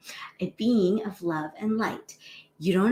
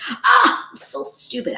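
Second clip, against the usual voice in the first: self-berating inner voice; 7 semitones higher, 7 dB louder than usual. Retold or imitated. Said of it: "So many of us".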